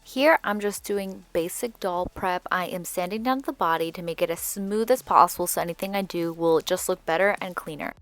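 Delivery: ordinary delivery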